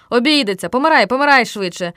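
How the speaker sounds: loud, impatient interruption. A narrator's reading. Boy rushing his friend to die in the game, impatient. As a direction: cut in and hurry him along. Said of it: "нетерпляче перебив Стьопа".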